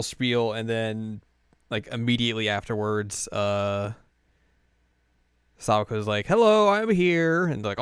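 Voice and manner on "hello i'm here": high, loud, slow sing-out greeting; hammed-up teacher bursting in; cheery entrance. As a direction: draw it out to announce yourself. Sawako's grand entrance, retold.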